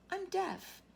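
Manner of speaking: ordinary delivery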